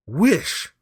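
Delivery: high, loud command; wife commanding husband to wish; strong cry. A narrator's reading. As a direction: order it forcefully. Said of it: "she cried in a strong voice".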